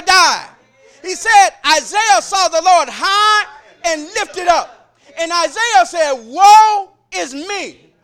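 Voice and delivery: loud, high shouted sermon; full-force preaching. Acted. Preacher calling the church to witness, loud and shouting. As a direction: call for a witness and launch the story.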